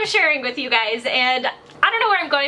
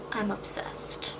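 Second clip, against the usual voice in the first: slow soft whisper of "I'm obsessed", barely voiced; breathy whisper; 4 semitones lower, 14 dB quieter, much slower than usual. Real, not acted. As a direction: drop to a secret hush and savour "I'm obsessed". Whispered aside about her lip.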